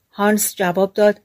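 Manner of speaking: ordinary delivery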